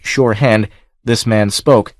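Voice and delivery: usual speaking voice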